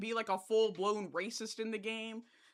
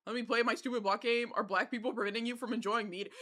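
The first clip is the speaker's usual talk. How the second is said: normal talking voice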